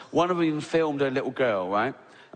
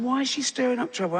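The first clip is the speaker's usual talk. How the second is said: much higher, faster disbelief; incredulous squeak; 10 semitones higher, faster than usual. Retold or imitated. Comic baffled by the angry tweeter, high and fast. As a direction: fire it off in disbelief. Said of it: "I'm going".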